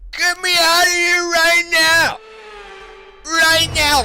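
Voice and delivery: high, loud furious yell; frustrated shouting. Real, not acted. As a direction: demand to be let out, shouting. Stuck after crash, furious.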